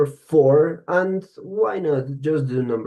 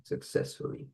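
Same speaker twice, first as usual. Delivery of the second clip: Successfully whispered with no voice; teacher whispers the word again as a reminder; whispered model; 12 dB quieter than usual. Shown as a demonstration. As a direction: breathe out Successfully as a hushed model.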